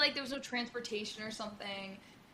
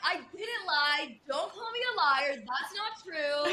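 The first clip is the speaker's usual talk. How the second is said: slow, higher indignant denial; defensive; 6 semitones higher, 3 dB louder, slower than usual. Retold or imitated. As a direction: protest that you are not a liar. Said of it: "And I'm like".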